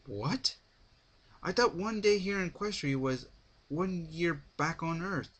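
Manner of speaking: a narrator's surprised outburst. A narrator's reading. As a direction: caught off guard, voice jumping up. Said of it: "it was my turn to be surprised".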